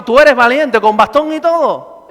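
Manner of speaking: loud preacher's insistence; loud exhortation. Real, not acted. Pastor assuring elderly churchgoers with canes, loudly. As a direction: press it on every listener.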